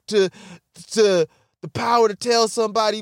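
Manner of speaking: ordinary talk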